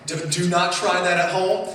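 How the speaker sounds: normal talking voice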